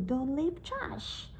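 "Don't leave trash" slow and soft, rising on "leave"; "leave" lifted, drawn out; demonstrated rise. Shown as a demonstration. Teacher points out the wrong rise on "Don't leave trash". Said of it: "tăng ngữ điệu vào cái từ".

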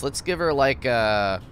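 normal talking voice

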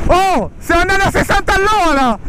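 high amazed shout. Real, not acted. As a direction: blurt it out in disbelief. Amazed at speed.

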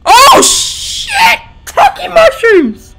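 high, loud shocked cursing; panicked swearing. Real, not acted. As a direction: swear in pure fright. Jump scare in horror game.

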